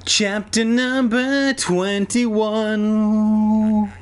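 very slow, high, stretched-out announcer voice; chapter announcement. A narrator's reading. Reader announcing the next chapter, slow high-pitched flourish. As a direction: drag out the heading, holding the last word.

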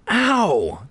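high, drawn-out cry of pain; pained yelp. A narrator's reading. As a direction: yelp after banging your head. Slams forehead into a cabinet.

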